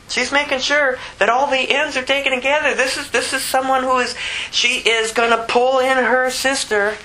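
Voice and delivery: high-pitched, insistent preacher; impassioned preaching. Real, not acted. Preaches with rising force.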